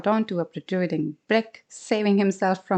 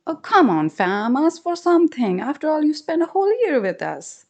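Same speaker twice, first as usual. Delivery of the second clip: louder, friendly urging; urging husband; 8 semitones higher, 4 dB louder than usual. Acted. Husband coaxing mongoose to ask for a gift, friendly. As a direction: coax him to ask.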